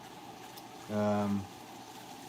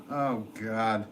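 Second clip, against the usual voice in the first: normal talking voice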